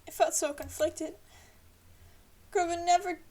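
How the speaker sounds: usual speaking voice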